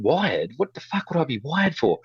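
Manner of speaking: high, scoffing disbelief; mock outrage at being called a cop; incredulous. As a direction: toss the accusation back as ridiculous. Retold reply to bully's accusation.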